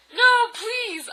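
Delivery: high, loud mock pleading; hammed-up desperate cry; begging. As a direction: plead it out melodramatically. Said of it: "I begged".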